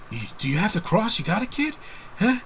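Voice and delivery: imitating the actor's trademark street-tough delivery; Mark Wahlberg impression. Reviewer mocking how Wahlberg talks in every movie, doing his voice. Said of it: "he talks like that in everything".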